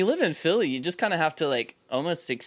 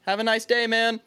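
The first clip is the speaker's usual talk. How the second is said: higher-pitched placating voice; mock-meek politeness; 6 semitones higher than usual. Backing down to the rude guy walking away, mock-meek farewell. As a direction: imitate yourself backing down nicely.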